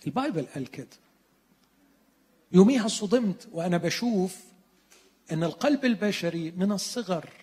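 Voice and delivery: ordinary talk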